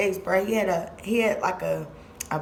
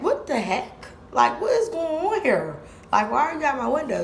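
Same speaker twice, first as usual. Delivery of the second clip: high, quick indignant questions; mom confronting; 6 semitones higher than usual. Retold or imitated. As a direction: demand answers from the stranger.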